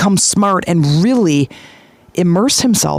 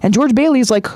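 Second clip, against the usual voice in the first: normal talking voice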